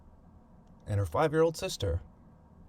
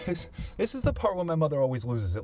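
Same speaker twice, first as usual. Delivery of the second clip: ordinary talk, nothing marked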